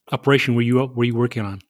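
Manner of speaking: usual speaking voice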